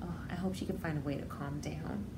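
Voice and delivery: ordinary delivery, nothing marked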